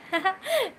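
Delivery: high, quick burst of laughter; short laugh. Real, not acted. Streamer asking a viewer to top up the data, short laugh. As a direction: break into a brief laugh.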